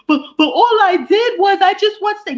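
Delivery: high, whiny, hammed-up fake-tearful lady; mock crying protester. Mocking the maced Capitol rioter, high whiny fake crying. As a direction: whimper it like a self-pitying victim. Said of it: "she over there like".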